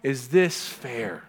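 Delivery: slow, rising indignant complaint; mock outrage. Preacher voicing someone hit by trouble, slow indignant complaint. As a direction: protest the unfairness. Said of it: "what would you say that to".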